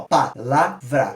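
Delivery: Palavra said very slowly, one breath per syllable; slow, separated pa-la-vra; split into syllables. Shown as a demonstration. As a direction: pull Palavra apart into pa, la, vra. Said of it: "Se a gente não falar assim".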